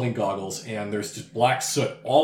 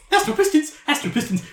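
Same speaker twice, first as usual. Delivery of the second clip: squeaky excited repeat; 4 dB louder than usual. Acted. Creature voices repeat.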